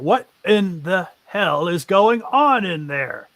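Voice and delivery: a guard yelling down at the intruders; shouting from upstairs. Acted. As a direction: bellow it down through the floor. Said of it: "a voice shouting from the room above".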